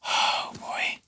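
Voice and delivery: whispered dread, barely audible; a hushed "oh, boy" on seeing the bully; fearful whisper. Retold or imitated. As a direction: breathe it out under the breath. Dread at spotting old bully.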